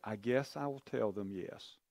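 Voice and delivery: quiet, hesitant, half-hearted yes; unconvincing. Shown as a demonstration. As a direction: say it like you don't believe it. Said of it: "That's how I said it".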